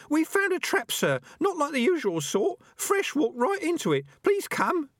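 very high, urgent squeaky rat voice; high-pitched rodent blurting bad news; breathless small rat. A narrator's reading. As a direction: plead in a tiny high rat voice. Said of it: "it burst out".

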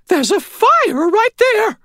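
very high, loud, drawn-out squawk; alarmed old lady. A narrator's reading. Old lady sees fire outside her bedroom, squeaky alarm. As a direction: cry out slowly in squeaky astonishment.

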